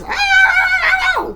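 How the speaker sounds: high, drawn-out mock scream; piercing mock wail of a mom; mommy's yell. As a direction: imitate a yelling mom's shriek. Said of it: "The kids hear this mommy voice".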